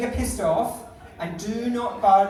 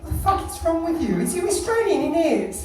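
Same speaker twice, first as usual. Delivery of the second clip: higher, incredulous London cashier imitation; irked London cashier; 7 semitones higher, 3 dB louder than usual. Cashier confronting a customer, mock irked London voice. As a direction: challenge them as a baffled London cashier. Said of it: "she looked up at me and she was like".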